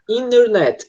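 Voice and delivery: "internet" said as "innernet", the T dropped; American swallowed T. Shown as a demonstration. Teacher showing how Americans say "internet", T swallowed. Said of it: "Eles engolem esse som de T".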